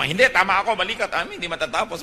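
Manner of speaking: high, loud stubborn insisting; mock quarrel. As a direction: insist like a child who won't back down. Arguing sibling voiced in story. (parang batang nakikipagtalo, malakas at mataas)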